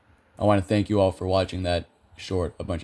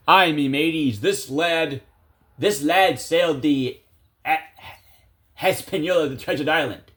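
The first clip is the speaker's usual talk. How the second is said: put-on pirate accent; 5 semitones higher than usual. Host reading a trivia clue in a pirate voice. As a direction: growl it like a ship's captain. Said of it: "I can't do a good pirate accent".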